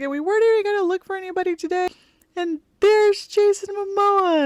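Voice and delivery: high wailing cry, rushed then slowing down; mock bawling. Daughter crying over seeing Jason Momoa, high fast wail. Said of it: "immediately started bawling".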